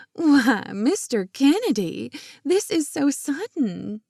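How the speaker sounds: put-on high, bright-eyed Southern belle accent, teasing; Scarlett O'Hara accent. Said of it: "in her best Scarlett O'Hara accent".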